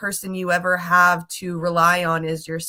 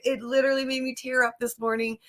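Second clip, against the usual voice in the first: ordinary delivery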